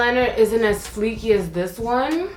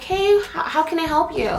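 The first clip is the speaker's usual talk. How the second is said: higher, chirpy customer-service greeting; fake work voice; 7 semitones higher than usual. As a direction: put on the sweet fake retail voice. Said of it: "your little fake little talk when you're at work".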